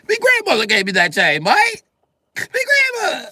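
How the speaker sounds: very high, loud British wail; pleading victim. Acted. Robbed British man pleading for grandmother's chain, high wail. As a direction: plead in a hammed-up squeal.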